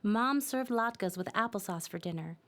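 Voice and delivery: ordinary delivery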